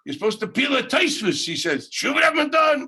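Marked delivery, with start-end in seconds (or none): 0.0-1.4 high, loud imitation of a ranting stranger; imitated yelling. Madman on the bus yelling at the young scholar, hammed-up shout. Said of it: "He starts screaming at me". 1.9-2.8 high, loud screamed scolding; mock scream. Madman on the bus screaming at him. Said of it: "He starts screaming at me in the bus".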